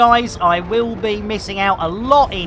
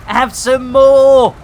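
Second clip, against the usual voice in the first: gleeful taunt; 4 semitones higher, 7 dB louder, slower than usual. Real, not acted. Taunting enemy while firing.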